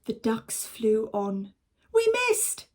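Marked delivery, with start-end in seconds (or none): 1.9-2.7 high, louder surprised hunter; Mister Gregg. Acted. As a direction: call it out high in disbelief. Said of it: "said Mister Gregg".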